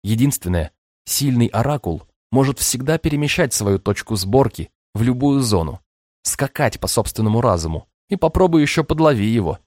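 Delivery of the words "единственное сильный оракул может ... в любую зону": ordinary delivery, nothing marked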